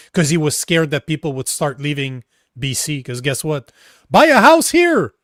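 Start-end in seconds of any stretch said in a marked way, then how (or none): 4.1-5.1 sarcastic. Retold or imitated. Mocking government voice.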